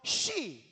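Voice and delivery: "shi" dengan desis SH panjang; bunyi SH. Shown as a demonstration. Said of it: "nggak bisa ngomong". ("shi" berbunyi SY yang ditahan)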